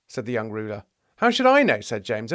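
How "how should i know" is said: high, louder indignant comeback; indignant retort. Acted. James rebuffs the young ruler's question, indignant. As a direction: throw it back, pitch jumping up.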